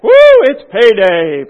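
loud, whooping glee; excited servant. Acted. Servant with five talents cheering on payday, loud whoop. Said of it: "Man, he's excited".

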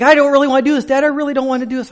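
high, loud mock wail; imitated crying child. Ten-year-old son to dad before the elevator, imitated crying. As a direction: imitate a kid wailing in protest. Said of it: "He starts crying".

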